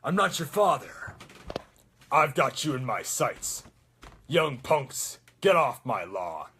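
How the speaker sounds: put-on loud, gruff old soldier; forceful, gruff war-veteran voice; Soldier seventy-six. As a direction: growl it like a grumpy veteran. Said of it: "Soldier seventy-six".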